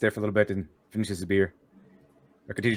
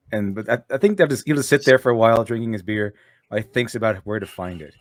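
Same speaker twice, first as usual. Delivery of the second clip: ordinary delivery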